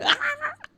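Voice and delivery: sudden very high yelp of pain; shrill pained squeal. A narrator's reading. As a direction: squeal like he stepped on a tack. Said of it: "let out a loud squeal".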